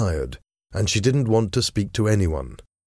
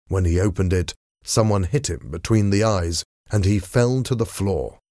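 ordinary delivery, nothing marked

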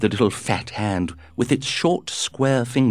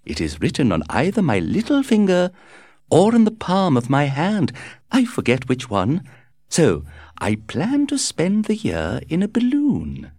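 lightly voiced society lady; 9 semitones higher than usual. A narrator's reading. Character speaking at party.

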